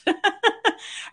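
a quick high laugh, real; high burst of laughter. Real, not acted. Laughs after her own line.

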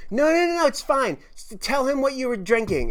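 put-on drunk girl insisting. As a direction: push it like a tipsy girl who won't drop it.

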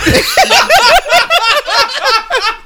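high squealing laugh; shrieking laugh. Real, not acted. Laughing fit.

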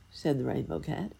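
plain reading voice

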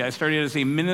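ordinary delivery

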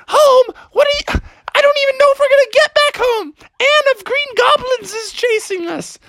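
high, hurried panicked protest; frantic frog. Acted. Froggy protests to sister during chase, high and frantic. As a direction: squeal in exasperated panic.

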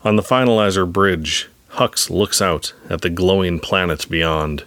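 plain reading voice

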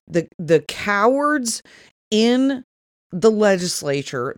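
ordinary talk, nothing marked

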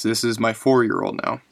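ordinary delivery

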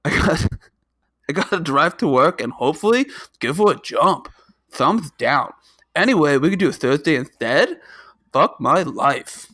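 high, loud goofy cartoon voice, squeaking up on please; hammed-up Homestar reading a text. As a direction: read the excuse text as a silly cartoon character. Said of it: "in my best Homestar".

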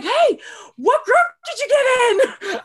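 put-on high, drawn-out twelve-year-old's question; imitated excited kid. Kid in the queue asks cheering guest, mock excited child. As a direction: imitate an eager kid calling across, high-pitched. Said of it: "like, twelve years old and he heard me".

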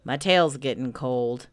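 resigned, put-upon grumble; gloomy Eeyore. Acted. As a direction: complain deep and long-suffering.

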